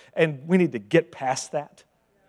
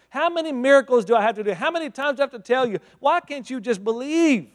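faster, louder, higher exasperation; exasperated rebuke; 6 semitones higher, 4 dB louder than usual. Acted. Jesus rebuking his disciples, exasperated and raised. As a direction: scold them, building frustration. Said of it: "Jesus looks at his guys ... says".

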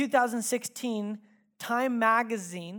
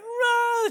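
very high, loud mock bawl of a small child; imitated toddler wail; 9 dB louder than usual. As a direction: burst into a two-year-old's cry. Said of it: "starts crying".

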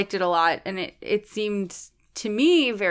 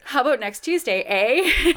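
put-on Canadian accent; 6 semitones higher, 6 dB louder than usual. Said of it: "Is that your Canadian accent?".

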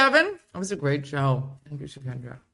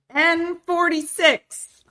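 slow, higher, louder call; bingo caller; 11 semitones higher, 5 dB louder, much slower than usual. Pretend. Showing Addie how to run bingo for the kids, as a bingo caller. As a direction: announce it across the hall.

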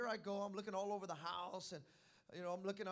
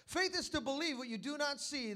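ordinary delivery

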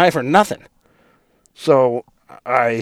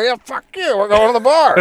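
put-on high, heated drunk yell; insist, getting madder; mock angry; 12 semitones higher, 5 dB louder than usual. Drunk husband to wife at the wheel, heated high yell.

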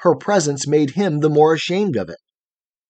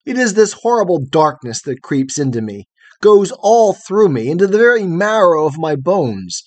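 horror of darkness; 6 semitones higher, 7 dB louder than usual. A narrator's reading. Frightened boy describes horror of darkness.

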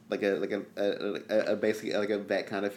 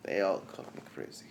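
mad doctor voice; 6 semitones lower, 13 dB quieter than usual. Acted.

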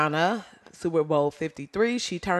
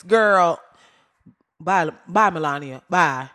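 loud sassy dismissal; 8 dB louder, slower than usual. Acted. Dismissing Melania with loud sass.

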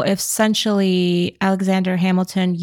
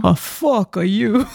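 slow, hammed-up French accent; much slower than usual. Shown as a demonstration. Listener answers as the French, exaggerated French accent. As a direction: draw it out in a mock French accent, laughing. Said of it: "in a French accent".

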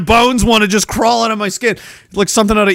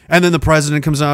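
ordinary talk, nothing marked

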